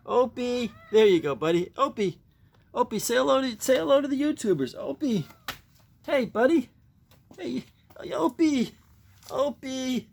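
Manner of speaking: high, sing-song call to a pet; high, coaxing pet-calling voice; calling the dog. Real, not acted.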